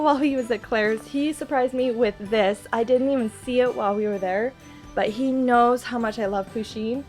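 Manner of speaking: usual speaking voice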